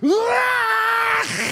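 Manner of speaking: high, loud startled yelp; the helpless kid yelping in a wrestling hold; mock cry of alarm; child's scream. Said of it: "He was like".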